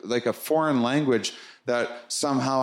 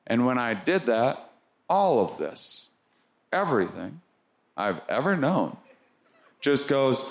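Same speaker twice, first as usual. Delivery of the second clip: ordinary talk, nothing marked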